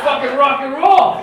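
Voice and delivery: loud, high-pitched rock fan holler; fan's cheer. Acted. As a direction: shout it wildly from the back row. Same fan cheers in silence.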